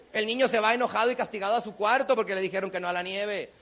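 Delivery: ordinary talk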